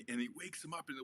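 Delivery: normal talking voice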